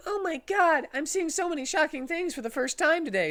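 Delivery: overwhelmed sidekick squealing in shock. Acted. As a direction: shriek it like nothing this horrible ever happened. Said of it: "said Knuckles Junior Sonic".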